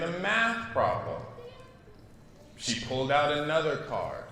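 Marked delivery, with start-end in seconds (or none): none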